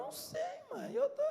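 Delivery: very high falsetto through a laugh; playing dumb. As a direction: squeak the dodge while giggling. Son plays dumb, laughing. (falsete rindo, fingindo inocência)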